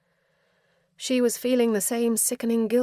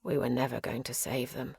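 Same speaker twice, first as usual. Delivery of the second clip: soft, calm resignation; softly; 6 semitones lower, 7 dB quieter than usual. A narrator's reading. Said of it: "Cyril said softly".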